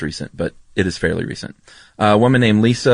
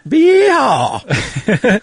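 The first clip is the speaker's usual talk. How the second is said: yeehaw; 4 dB louder than usual. Pretend. Mimicking Jesse firing his gun.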